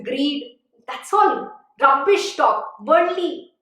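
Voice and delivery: ordinary talk, nothing marked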